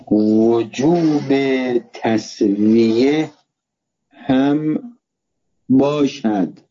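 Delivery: drawn-out words with long gaps between them; very slow dictation. Real, not acted. Teacher giving students a sentence to write, very slow dictation. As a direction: dictate it slowly, one word at a time.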